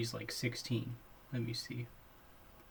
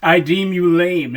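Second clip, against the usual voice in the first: slow, loud, solemn decree; mock pronouncement; 3 semitones higher, 10 dB louder than usual. Pretending to pass judgment on friend, mock solemn decree. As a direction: declare it like a judge, slowly and loudly. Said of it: "acting out friend's verdict".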